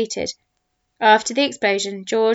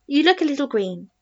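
worried; 5 semitones higher than usual. A narrator's reading. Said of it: "He sounded worried".

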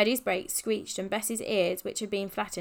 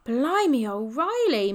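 raised, louder amazement; awed exclamation; 4 semitones higher, 7 dB louder, slower than usual. A narrator's reading. Freddie marvelling at the cars, awed. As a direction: let the wonder swell on each word. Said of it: "murmured Freddie eventually".

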